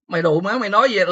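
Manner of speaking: high, brushing-off Vietnamese jab; dismissive tone. Retold or imitated. The OG brushes off the young crew, dismissive. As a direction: wave them off in Vietnamese. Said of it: "said it in the most dismissive tone".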